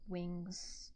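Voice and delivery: usual speaking voice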